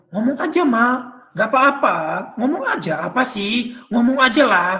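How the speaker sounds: husband hammering at his hesitant wife; impatient husband. Acted. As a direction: press her to just say it. Husband urging wife to speak.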